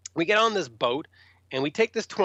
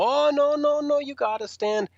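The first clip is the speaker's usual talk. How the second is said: high, fussy mock refusal; insistent line official; 10 semitones higher than usual. Festival staff stop tour guys in line, high and insistent. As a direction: imitate staff cutting someone off with objections.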